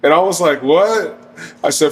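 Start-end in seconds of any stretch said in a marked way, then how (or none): 0.6-1.2 higher-pitched mock disbelief; retold incredulous reaction. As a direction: act out a taken-aback "what". Retold reaction to threat of cops.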